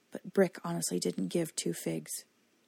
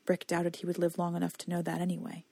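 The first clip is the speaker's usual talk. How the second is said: ordinary delivery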